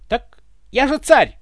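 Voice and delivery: high, loud, drawn-out protest; indignant. A narrator's reading. Ivan insists he is the tsar, indignant. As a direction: protest loudly, pitched high. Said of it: "возмутился Иван".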